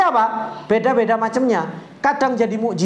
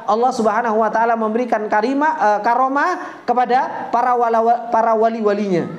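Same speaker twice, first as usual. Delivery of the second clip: ordinary talk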